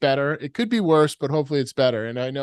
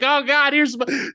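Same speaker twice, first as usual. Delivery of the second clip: mock desperate plea; 11 semitones higher, 3 dB louder than usual. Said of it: "You're like".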